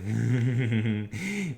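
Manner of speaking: mock horror-host laugh; a little theatrical villain chuckle; spooky laugh. Shown as a demonstration. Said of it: "do the Vincent Price".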